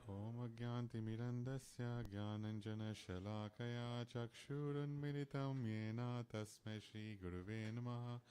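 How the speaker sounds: rapid, rhythmic Sanskrit prayer; prayer recitation. Acted. Offering the prayers to the guru before the class, rapid recitation. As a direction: rattle off the invocation prayers fast.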